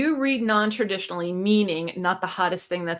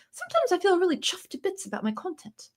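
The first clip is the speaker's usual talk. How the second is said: playful put-on British accent, higher and softer; 9 semitones higher, 5 dB quieter than usual. Shown as a demonstration. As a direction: say it like a cheeky Brit pleased with her videos. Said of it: "I should talk in a British accent".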